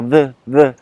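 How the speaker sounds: "the" with a thick lisped th, self-mocking; soft lisped th. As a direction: imitate feeling silly lisping "the". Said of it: "comme un, comme un gogol, quoi, de faire". (« the » zozoté, pour se moquer de soi)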